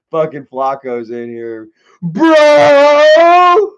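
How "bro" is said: high, loud yell of disbelief; real shout at what he sees. Real, not acted. Reacting loudly to chat.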